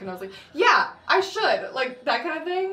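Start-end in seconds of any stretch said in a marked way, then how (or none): 0.5-1.7 mock eager agreement.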